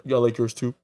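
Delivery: deep voice. Retold or imitated. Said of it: "He's like".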